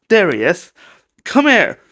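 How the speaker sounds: normal talking voice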